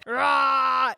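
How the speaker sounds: loud shouted T-Rex roar; dinosaur roar. Pretend. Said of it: "give us your best dinosaur roar".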